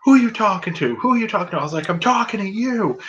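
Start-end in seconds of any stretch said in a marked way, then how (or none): 0.0-1.6 high-pitched challenge, acted out; retold confrontation. Wrestler turning on a heckling fan, mock indignant challenge. 1.9-3.0 higher-pitched mock defiance; retold defiant retort. Heckling fan answering the wrestler, mock defiant.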